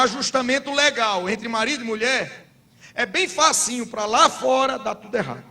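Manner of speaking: high, forceful preacher's cry; preaching at full force. Acted. Pastor exhorts the church about sin, preaching loudly. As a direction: pastor drives the point home to the congregation.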